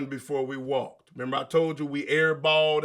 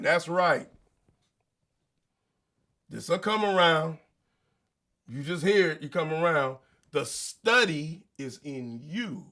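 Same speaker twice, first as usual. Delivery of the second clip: ordinary delivery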